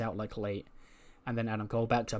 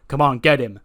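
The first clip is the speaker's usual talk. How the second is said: loud rallying call to a teammate; a mock shout urging a partner into the fight; 11 dB louder than usual. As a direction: egg him on, loud. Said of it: "And Dolph goes".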